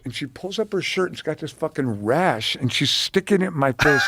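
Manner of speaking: ordinary delivery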